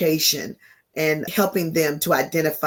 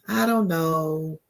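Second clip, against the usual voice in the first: demo sigh; slower than usual. Shown as a demonstration. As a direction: show the weary grief sigh. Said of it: "doing this".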